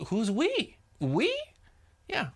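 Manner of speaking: high, incredulous "who's we?"; scoffing. Real, not acted. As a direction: scoff in disbelief, pitch flying up. Preacher scoffs at the demon.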